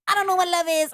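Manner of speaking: high-pitched mock-earnest line reading; [name]. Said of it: "The way that [name] delivers these lines".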